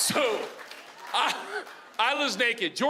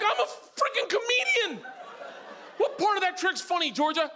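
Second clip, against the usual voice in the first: high, exasperated comic protest; mock indignation; 10 semitones higher than usual. Comedian dad challenging his daughter's humour, mock indignant. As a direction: argue back, hammed-up and offended.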